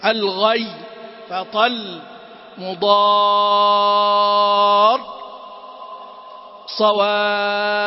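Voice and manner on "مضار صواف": صواف and مضار drawn out slowly, full shadda held at the stop; stress on the final letters. Shown as a demonstration. Teacher reciting verses to show the stressed pause. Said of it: "هكذا".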